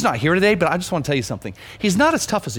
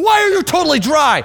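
high, loud scolding shout; urgent raised-voice demand; re-enacted yell; 12 semitones higher, 5 dB louder than usual. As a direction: yell it at someone in alarm. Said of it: "I yelled at him".

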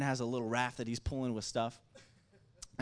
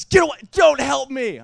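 loud, higher angry shout; retold yell; 10 semitones higher, 17 dB louder than usual. Dad shouting his son away from the kayak, loud angry yell. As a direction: push him off with your voice.

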